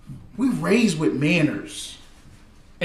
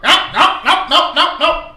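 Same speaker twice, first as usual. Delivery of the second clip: higher and louder, rapid no, no, no; mock cop refusal; 7 semitones higher, 4 dB louder than usual. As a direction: shut him down like a stubborn cop. Cop refusing to let him go.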